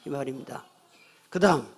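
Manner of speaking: ordinary delivery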